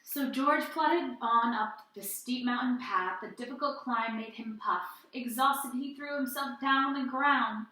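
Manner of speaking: put-on lively read-aloud to children; animated children's story reading; storytime narration. A narrator's reading. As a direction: tell it like a picture book at storytime. Reads picture book aloud.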